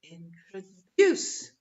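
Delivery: 'introduce' slowly as in-tro-DUCE, last beat loud; third-syllable stress. Shown as a demonstration. Teacher naming the stressed syllable, third-syllable stress. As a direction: hold back, then punch DUCE in 'introduce'. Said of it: "the stressed syllable is".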